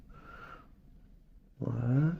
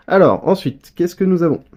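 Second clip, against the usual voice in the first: excited; 7 semitones higher, 6 dB louder than usual. Real, not acted. Laughing, eager to see cards.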